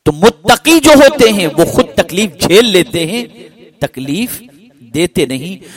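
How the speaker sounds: high, loud preaching cry; impassioned preaching. Acted. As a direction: lift the line into a fervent sermon peak. Preacher builds to full force. (پرجوش خطیبانہ انداز)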